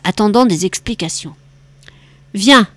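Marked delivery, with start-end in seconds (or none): none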